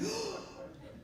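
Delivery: high, soft mock gasp; imitating a mortified woman's gasp. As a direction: suck in a horrified breath. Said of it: "she looks mortified".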